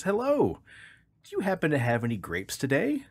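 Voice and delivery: high, chirpy duck greeting. Acted. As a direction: play the cheeky duck. Duck third day.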